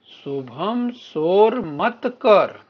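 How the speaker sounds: very slow, loud, each word spaced apart; slow clear reading. Shown as a demonstration. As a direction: spell out the sentence for the class. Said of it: "आगे बोलिए". (धीमी, ज़ोरदार, साफ़ पढ़ाई)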